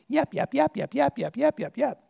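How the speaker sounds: yappy little dogs. Retold or imitated. Preacher mocking the scribes as yapping dogs, high yaps. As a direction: yap it out like a tiny barking dog. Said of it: "they were like little yappy dogs".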